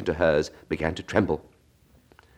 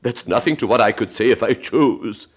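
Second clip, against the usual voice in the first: pleased, self-satisfied Duchess, a bit louder; 5 semitones higher, 4 dB louder than usual. Acted. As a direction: preen over her own cleverness. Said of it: "in a pleased tone".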